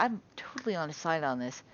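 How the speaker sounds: normal talking voice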